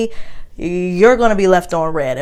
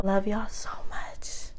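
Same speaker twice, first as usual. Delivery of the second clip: slow, hushed whisper to the viewers; affectionate whisper; 12 dB quieter than usual. Real, not acted. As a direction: whisper your love slowly and tenderly.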